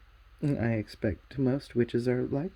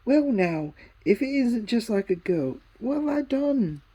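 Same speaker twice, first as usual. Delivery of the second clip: higher, louder, rising outrage; indignant boy; 9 semitones higher, 6 dB louder than usual. A narrator's reading. Diggory indignant at Polly, higher louder outrage. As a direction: protest you've done nothing wrong.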